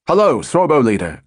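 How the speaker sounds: lightly voiced radio call; a hint of a controller calling up; calling over radio. A narrator's reading. Controller calling up the squadron leader, light radio call. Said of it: "called the controller at Middle Wallop".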